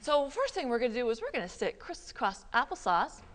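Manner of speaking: ordinary delivery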